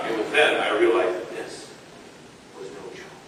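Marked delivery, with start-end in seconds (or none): none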